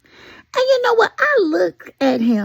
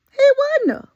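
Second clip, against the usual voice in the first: emphatic; 11 semitones higher, 3 dB louder, faster than usual. Real, not acted. Emphatic insistence.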